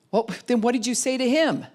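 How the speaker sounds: high, loud and exasperated; hurried and put out; busy parent, irritated and rushed; disgusted. Retold or imitated. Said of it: "we're disgusted".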